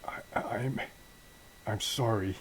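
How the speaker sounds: acted breathless, halting apology; short of breath. Beaten hero looking at his daughter's picture, short of breath. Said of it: "he's short on breath".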